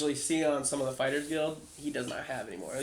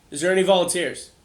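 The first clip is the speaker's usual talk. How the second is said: calling out; 4 semitones higher, 8 dB louder than usual. Acted. GM calls to the crowd.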